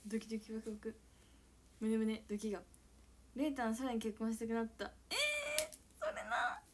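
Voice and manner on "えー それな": squealing agreement. Real, not acted.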